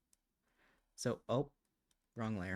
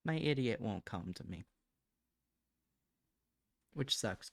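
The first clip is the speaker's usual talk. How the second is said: ordinary delivery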